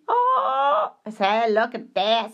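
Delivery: a plummy gentleman spotting a friend; posh exclamation. Pretend. Mock posh delight at Augustine.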